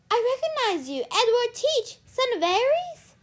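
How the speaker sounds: high, loud amazement; gasping recognition. A narrator's reading. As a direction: exclaim it in astonished recognition. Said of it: "Annabeth gasped".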